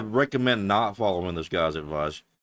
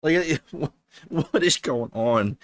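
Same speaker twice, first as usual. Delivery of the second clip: laughing disbelief; 6 semitones higher, slower than usual. Real, not acted.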